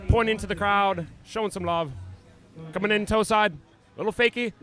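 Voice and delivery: hammed-up Aussie announcer; Australian accent. Commentator calling pass two in mock Australian accent. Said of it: "try to do our Australian accents".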